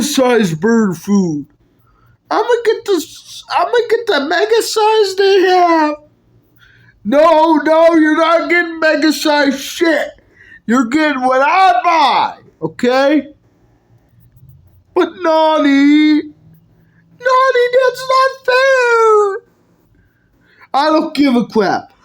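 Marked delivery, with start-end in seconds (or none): 2.3-6.0 high, crying whine; whiny kid voice. Acted. Kid whining for mega size. 17.2-19.4 high, very slow whine; whiny protest. Acted. Kid protesting to nanny.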